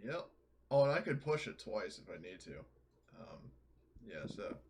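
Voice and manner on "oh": usual speaking voice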